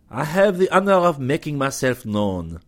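French commander proclaiming his name. A narrator's reading. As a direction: announce yourself like a courtly Frenchman. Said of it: "he proclaimed".

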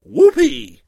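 high, loud whoop; a second whooping to guide the duelists; rousing whoopee. A narrator's reading. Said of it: "raised a rousing".

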